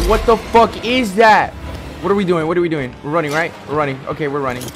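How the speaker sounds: loud high frantic panic; panicked yelling. Real, not acted. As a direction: shout in a rush of fear. Chased in horror game.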